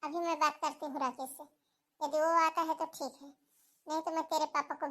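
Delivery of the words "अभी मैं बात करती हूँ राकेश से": ordinary talk, nothing marked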